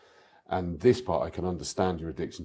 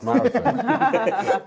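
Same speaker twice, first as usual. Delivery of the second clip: laughing; 8 dB louder, much slower than usual. Real, not acted. Self-mocking race story.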